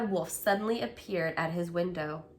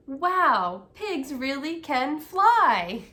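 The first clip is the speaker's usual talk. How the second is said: amazed cheering; 6 semitones higher, 4 dB louder, slower than usual. Acted. Citizens of Fairyland cheer.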